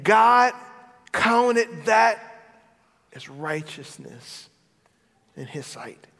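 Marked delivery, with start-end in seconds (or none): 0.0-2.2 swelling emphasis. Acted. Preacher swells after the hush.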